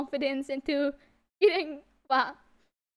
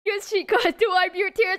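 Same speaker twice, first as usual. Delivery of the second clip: ordinary talk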